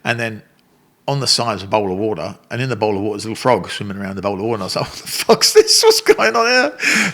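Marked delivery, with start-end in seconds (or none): 4.9-7.1 very high, loud squawk of disbelief; hammed-up high-pitched outburst; acted-out shock. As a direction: shriek it in comic bewilderment. Shocked at frog in toilet water.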